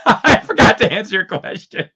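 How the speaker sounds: giggling aside; laughing. Real, not acted. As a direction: laugh while speaking. Laughing while talking.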